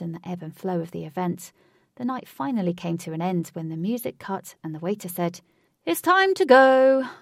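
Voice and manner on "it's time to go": high, loud, drawn-out announcement; calling out. A narrator's reading. Waiter to the partygoers, loud drawn-out call. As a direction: sing out closing time slowly. Waiter calls closing time.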